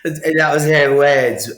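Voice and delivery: laughing out loud, high and loud. Real, not acted. Laughing at his therapist's blunt words. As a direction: crack up at the memory.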